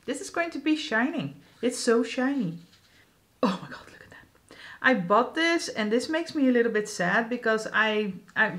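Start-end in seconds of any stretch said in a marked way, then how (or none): 3.4-4.6 hushed, breathy whisper of awe; awed whisper. Real, not acted. Whispering to viewers over a shiny highlighter, awed. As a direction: whisper it like you can't believe how shiny it is.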